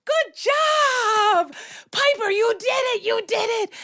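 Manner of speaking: high, elated squeal of praise; excited cheering. Retold or imitated. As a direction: cheer on a child who succeeded. Cheering a friend's success.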